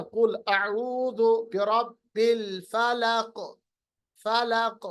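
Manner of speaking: slow recitation, फलक ending in an echoing क; qalqalah demo. Shown as a demonstration. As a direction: recite measured, bounce the क of फलक. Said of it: "आप मेरी आवाज पे गौर कीजिए". (धीमी तिलावत, फलक पर क़लक़ला)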